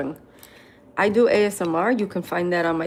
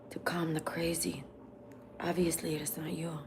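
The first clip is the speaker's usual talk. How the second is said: whispered aside; 10 dB quieter than usual. Real, not acted. Quiet aside after reading comment.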